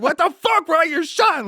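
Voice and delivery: very high, alarmed cry; shocked friend realizing he's shot; panicked buddy. Retold or imitated. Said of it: "he's like".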